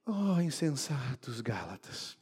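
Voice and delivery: baixinho, bem lento, quase suspirado; lamento suave. Shown as a demonstration. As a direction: diga como um lamento fraco. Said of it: "Será que é esse o tom? Claro que não". (Oh, insensatos Gálatas dito baixo e arrastado)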